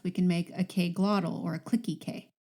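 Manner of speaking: ordinary talk, nothing marked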